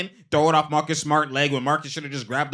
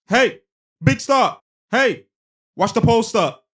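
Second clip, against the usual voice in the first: high, loud call to a teammate; 9 semitones higher, 7 dB louder, slower than usual. Retold or imitated. Player telling Horford to watch the post, loud high call. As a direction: shout instructions across the court. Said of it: "He's telling Horford".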